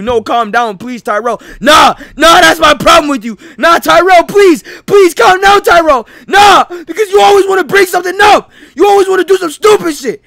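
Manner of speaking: high, loud shouting quarrel; heated argument. Acted. Tyrell and Diego fighting, loud furious shouting. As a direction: yell over each other in a fight.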